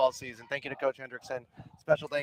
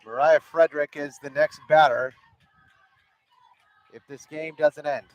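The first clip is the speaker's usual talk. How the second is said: play-by-play; 3 semitones higher, 6 dB louder than usual. Acted. Live softball play-by-play.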